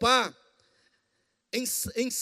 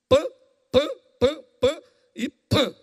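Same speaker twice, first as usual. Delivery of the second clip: mock punches; 4 semitones higher, 5 dB louder, slower than usual. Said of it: "Jesus pra mim faz isso aqui".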